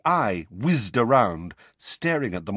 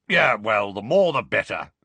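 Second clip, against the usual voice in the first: put-on loud, dark growly old wizard; 5 dB louder than usual. A narrator's reading. Old guard to Harry on extra protectors, dark. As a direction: say it darkly, with weight. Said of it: "said Moody darkly".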